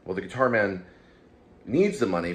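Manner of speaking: ordinary talk, nothing marked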